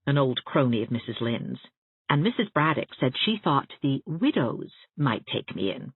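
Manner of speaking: usual speaking voice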